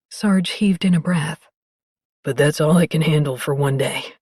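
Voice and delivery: low, relieved, overwhelmed man; low and breathy with relief; deep male voice. Acted.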